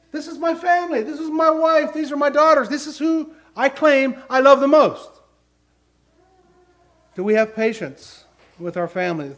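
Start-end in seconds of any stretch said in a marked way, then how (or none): 0.1-5.1 high, louder emphatic sermon lines; impassioned preaching. Real, not acted. Preacher telling the congregation who he loves most, impassioned. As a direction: drive the point home with fervour. Preacher stresses family, rising.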